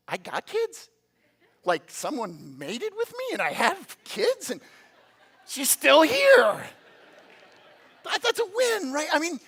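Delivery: slow, incredulous build to a high, loud finish; mock amazement. Parent astonished at having kids and a partner, hammed-up amazement. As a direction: marvel at it, stretching the last words.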